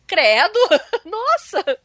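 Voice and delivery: retold shock. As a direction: recall being taken aback, laughing it off. Retells her shocked reaction, laughing. (espanto relembrado, agudo e rindo)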